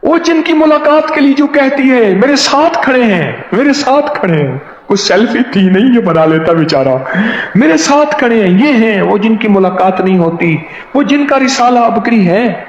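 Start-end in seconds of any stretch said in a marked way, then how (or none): 2.2-4.6 higher, bubbling over on the phone to his wife; can't believe he's standing right here; excited phone call. Retold or imitated. 7.5-12.6 higher, bubbling over on the phone to his wife; can't believe he's standing right here; excited phone call. Retold or imitated. Shopkeeper repeats excitedly on phone.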